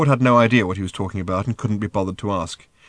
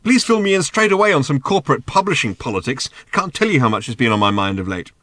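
raised voice, mock eagerness; sarcastic shouting; 5 semitones higher, 5 dB louder than usual. A narrator's reading. Arthur scorning talk of publishing politics, sarcastic shouting. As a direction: lay on the sarcasm loudly. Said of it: "he shouted".

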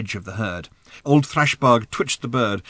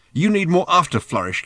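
high-pitched argumentative retort; countering; 7 semitones higher, 3 dB louder than usual. A narrator's reading. Ford bickering with Arthur over technique, countering. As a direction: fire the criticism straight back. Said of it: "countered Ford".

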